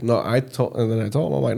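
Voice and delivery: usual speaking voice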